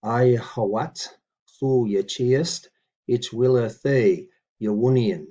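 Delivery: slow, deliberate word-by-word recitation; measured teaching read; careful Old English reading. Shown as a demonstration. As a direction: read each word out for the learner. Reads Old English model sentence.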